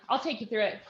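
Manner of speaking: ordinary delivery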